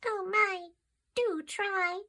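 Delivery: fly voice, high and light; tiny fly. A narrator's reading. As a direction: squeak it like a little fly. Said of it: "said the fly".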